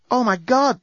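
ordinary delivery

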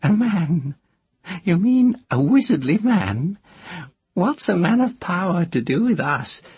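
put-on high, teasing old witch; a cackling village witch. A narrator's reading. Moss voiced.

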